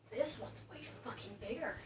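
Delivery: ordinary delivery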